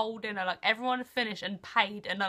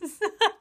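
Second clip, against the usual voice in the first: high excited laugh; 11 semitones higher, 9 dB louder than usual. Real, not acted.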